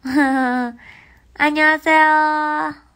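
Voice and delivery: a loud, stretched-out hello in Korean; 大声で引き伸ばした挨拶. Real, not acted. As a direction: call out the greeting big and long. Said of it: "greeting fans in Korean".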